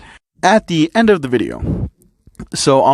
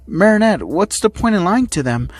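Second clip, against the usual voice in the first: higher-pitched little creature cutting in; Tiki interrupting; 5 semitones higher than usual. Acted. Tiki confronting Marinette about lying, interrupting. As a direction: break in with a raised, chiding voice. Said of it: "Tiki interrupting".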